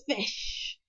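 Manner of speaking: voiceless hissed demand; dragon hiss. A narrator's reading. Toothless demanding food from Hiccup, hissed. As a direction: hiss it softly like a little dragon. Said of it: "hissed Toothless".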